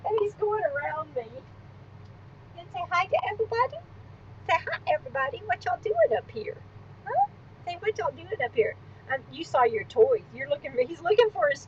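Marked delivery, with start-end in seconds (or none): 2.6-8.8 higher, softer coaxing pet voice; soft, high puppy talk. Real, not acted. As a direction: sweet-talk the dog over to you.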